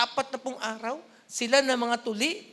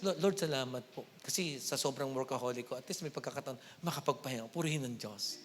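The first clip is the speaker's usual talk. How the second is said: much lower, softer grateful prayer; mock sick prayer; 10 semitones lower, 7 dB quieter than usual. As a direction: pray quietly from a sickbed. Voicing a sick person's prayer.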